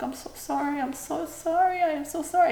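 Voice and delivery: soft, high, pained apologizing; retold tender apology. Mom holding her crying daughter's hand, tender apology. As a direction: reenact a pleading, hushed sorry.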